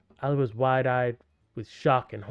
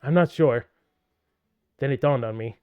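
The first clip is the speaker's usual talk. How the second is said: normal talking voice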